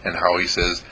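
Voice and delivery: usual speaking voice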